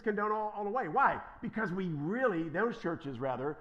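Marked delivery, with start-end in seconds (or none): none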